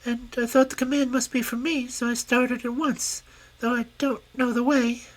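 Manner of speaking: a cheeky tiny grasshopper; lightly squeaky grasshopper princess; little grasshopper. Acted. Grasshopper voiced in story.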